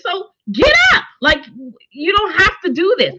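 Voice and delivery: high urgent command; exasperated. Real, not acted. Viewer urging the girl to get up, high and exasperated. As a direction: tell her to get up, pitched way high. Scolding the character.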